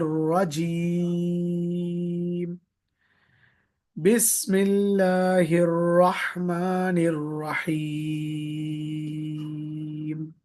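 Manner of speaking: drawn-out melodic tilawat, long held vowels; stretched chanted recitation; slow Quranic recitation. Shown as a demonstration. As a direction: recite slowly and melodiously like tajweed class. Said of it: "पूरा एक साथ बोलेंगे".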